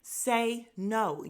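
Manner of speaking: loud insistent coaching; urging loudly. Retold or imitated. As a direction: push her to say it, loudly. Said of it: "And I'm like".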